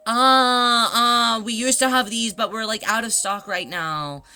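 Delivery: drawn-out uh, then a breezy fib; made-up clerk excuse. Pretend. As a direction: improvise a shop excuse, slowing at the end. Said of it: "come up with some other random excuse".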